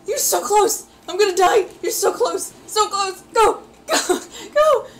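high, desperate gamer cries; frantic urging. Real, not acted. Player so close and about to die, frantic. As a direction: cry out as victory hangs by a thread. Near win, afraid of dying.

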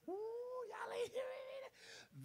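a real high-pitched cry at the congregation. Real, not acted. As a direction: cry out high, then soften to almost nothing. Preacher crying out to congregation.

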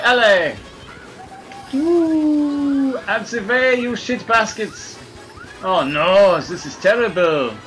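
ordinary talk, nothing marked

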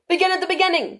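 high-pitched, solemn storybook king; grave king. A narrator's reading. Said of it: "the king said very gravely".

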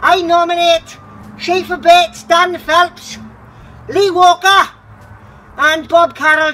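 loud, high, gasping from the cold; in ice cold water. Real, not acted. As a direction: blurt the names while freezing. Just soaked in ice water.

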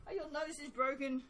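high, whiny mock despair; a hammed-up cry of despair; mock whine. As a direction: plead with them in fake anguish. Mock plea about sandalwood.

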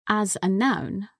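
ordinary talk, nothing marked

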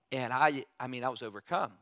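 ordinary talk, nothing marked

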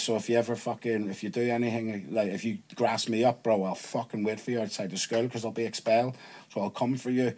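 soft, controlled retold threat; understated menace, kept quiet. Acts out threatening the teacher.

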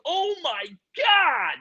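high, slow drawn-out amazement; mock amazed gasp. Everyone seeing the plate-sized frog, high slow awe. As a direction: stretch it out, wide-eyed crowd. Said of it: "Everyone's like".

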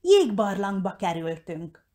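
ordinary delivery, nothing marked

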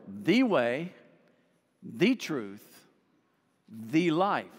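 "the" said as a long "thee" before each noun; stress on THE. Shown as a demonstration. Preacher pointing out the definite article, stressed "the" in each phrase. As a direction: hit "the" each time, pausing between items. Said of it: "notice the definite article".

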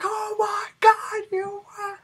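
very high, loud, stretched-out squeal; excited shriek. Retold or imitated. Brother shrieks the news, very high and loud. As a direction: squeal with total disbelief and joy. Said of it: "He's like".

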